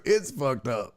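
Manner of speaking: usual speaking voice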